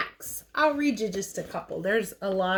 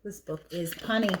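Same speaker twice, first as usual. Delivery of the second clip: usual speaking voice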